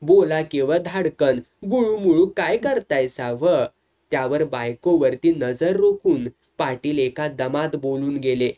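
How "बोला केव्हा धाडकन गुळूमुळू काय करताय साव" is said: usual speaking voice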